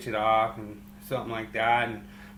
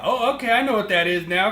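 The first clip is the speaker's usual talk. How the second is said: mock realization; 9 semitones higher, 4 dB louder than usual. Realising offsides rule.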